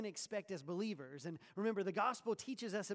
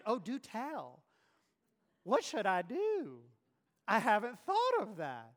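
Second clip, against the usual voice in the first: sarcastic; 9 dB louder, slower than usual. Retold or imitated. Sarcastic eye-roll voice.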